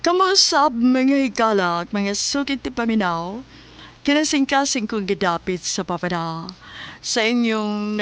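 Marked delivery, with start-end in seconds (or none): none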